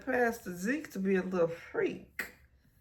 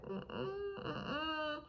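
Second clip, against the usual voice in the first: soft, high whimpered mm-mm; 9 semitones higher, 11 dB quieter than usual. Real, not acted. Watching the car roll up, soft high whimper. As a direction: whimper a frightened no. Dreading the drive-by.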